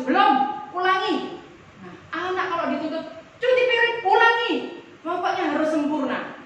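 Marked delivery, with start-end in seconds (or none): 0.0-1.4 a sharp, raised no from a strict father; demanding parent. Acted. Strict father telling his child to redo it, sharp and raised. 3.4-4.5 high, loud barked order; harsh command. Acted. Parent barking at child to rewash the dishes, high and loud.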